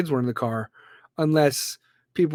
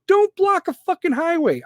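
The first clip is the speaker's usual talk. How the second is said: exasperated rant; 12 semitones higher, 3 dB louder than usual. Real, not acted. Ranting about highway protests.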